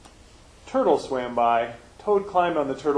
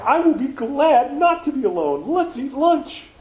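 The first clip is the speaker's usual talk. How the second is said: much higher and louder frog voice; happy Frog voice; 11 semitones higher, 5 dB louder than usual. Acted. Frog reassuring Toad on the island, high happy voice. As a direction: Frog reassuring his friend. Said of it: "said Frog".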